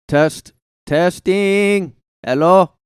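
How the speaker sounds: testing loudly, pitched high; loud mic test. Real, not acted. Host checking if he is loud enough, loud calling-out.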